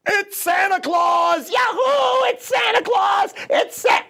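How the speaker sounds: high, joyful cheering; excited shout. Acted. Pigs mistaking the wolf for Santa, cheering. Pigs hear roof noise.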